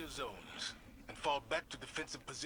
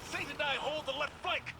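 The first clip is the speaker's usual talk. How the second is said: battle shout; 12 semitones higher than usual. Acted. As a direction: bark it across the battlefield. Commander calling over battle noise.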